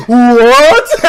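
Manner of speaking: loud rising disbelief; stunned, high and loud; shocked what. Retold or imitated. Bully hearing my answer, loud shocked what.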